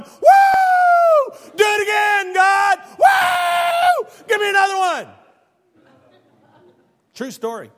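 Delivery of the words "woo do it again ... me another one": put-on child whooping out a window; very high, very loud delighted whoops; gleeful shouting. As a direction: yell up at the storm with joy. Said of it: "like, shouting, screaming".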